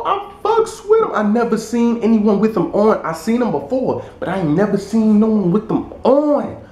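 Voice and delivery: very high-pitched mock excitement; high, gushing retold praise; hyped-up admirer. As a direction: imitate an impressed hype man up high. Acting out the admirer's hype.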